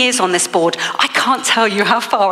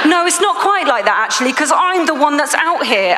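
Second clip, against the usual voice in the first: angry retort; 6 semitones higher than usual. Retold or imitated. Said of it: "And I was so angry with them".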